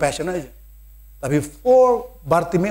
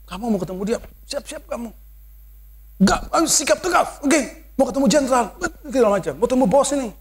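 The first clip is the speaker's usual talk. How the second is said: higher, taunting crowd voice scaring the blind man; mocking intimidation; 6 semitones higher than usual. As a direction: tease him like a bully trying to scare him. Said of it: "Jadi dibuat begitu, dia takutin lagi". (suara mengejek yang menakut-nakuti)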